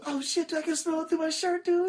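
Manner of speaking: mock disgust, squeaking over the smell; imitating Tom. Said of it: "He goes".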